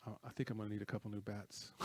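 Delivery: low-key, quick, soft punchline; understated, muttered aside; retold deadpan joke. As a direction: toss it off quietly as a retold joke. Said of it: "kinda shakes his head and he goes".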